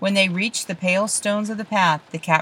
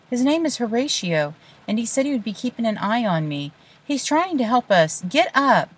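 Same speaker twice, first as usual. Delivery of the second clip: urgent insisting; 6 semitones higher than usual. Acted. Olive pushes boy to move.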